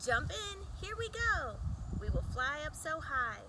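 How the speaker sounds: plain reading voice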